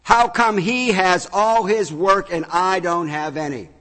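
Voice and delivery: high, loud indignant protest; loud whiny outrage; irate. Acted. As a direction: shout it like a sore loser. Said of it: "became irate".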